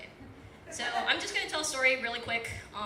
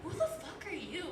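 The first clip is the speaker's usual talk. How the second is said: higher, mock bewildered kids; kids' baffled reply; 5 semitones higher, 4 dB quieter than usual. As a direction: play the friends, baffled. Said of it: "they're like".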